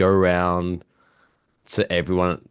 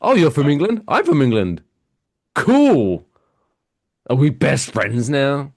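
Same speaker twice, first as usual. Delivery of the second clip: higher, louder sarcastic reply; mock sarcastic; 6 semitones higher, 5 dB louder than usual. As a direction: ham up the mocking with rising disbelief.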